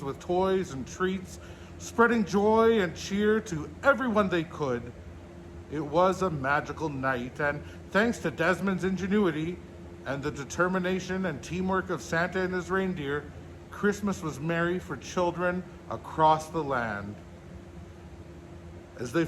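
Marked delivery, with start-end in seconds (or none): none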